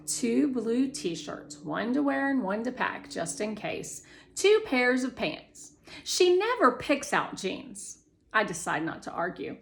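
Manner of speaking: ordinary delivery, nothing marked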